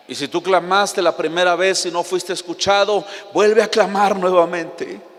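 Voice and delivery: preacher urging the church, loud and insistent; fervent preaching. Real, not acted. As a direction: press the congregation, rising to full force. Preaching climax.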